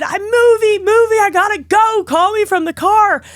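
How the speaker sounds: high, loud frantic blurt; high excited mock panic; frantic excitement. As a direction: rush it out overjoyed. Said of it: "And I said".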